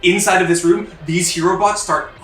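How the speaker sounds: loud, high-energy game master narration; breathless-paced escape narration at full volume; urgent scene-setting. A narrator's reading.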